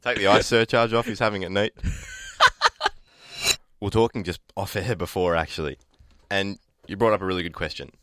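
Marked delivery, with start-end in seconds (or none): 1.8-2.9 shrieking high laugh. Real, not acted. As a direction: burst into a squealing laugh. Big laugh.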